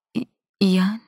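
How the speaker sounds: stunned, halting «ای-، ایان؟» rising on ایان; breath caught. A narrator's reading. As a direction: stumble on «ای-» and lift ایان into a question.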